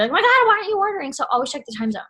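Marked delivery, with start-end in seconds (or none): 0.1-1.1 impatient. Retold or imitated. Mock impatient seller.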